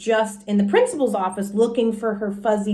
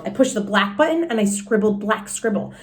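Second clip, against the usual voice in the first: usual speaking voice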